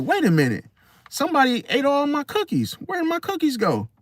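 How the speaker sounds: high-pitched baffled discovery; puzzled alarm. Acted. Person finding the cookie jar empty in the morning, high and baffled. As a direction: raise it high, as if just finding something missing.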